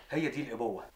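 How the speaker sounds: ordinary delivery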